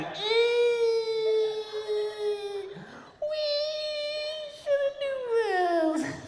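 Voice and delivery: very high, slow, soft squeal; squealing deer. Acted. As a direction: squeak it out like a deer sliding along glass. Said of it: "It's like that".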